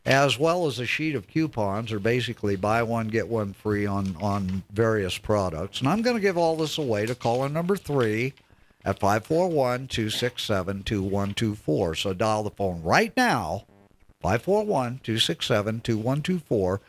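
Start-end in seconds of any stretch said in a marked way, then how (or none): 5.8-16.8 upbeat radio giveaway read; radio contest promo. Acted. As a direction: sell the giveaway like a DJ. Host reads giveaway promo.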